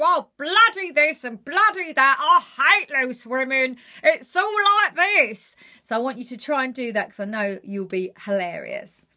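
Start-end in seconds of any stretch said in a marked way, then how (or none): 0.0-5.6 higher, louder grumbling moan; loud, high, fed-up griping; miserable Janet. Shown as a demonstration. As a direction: complain about everything as Janet. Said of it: "with Janet".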